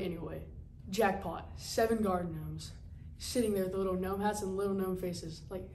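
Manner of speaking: ordinary delivery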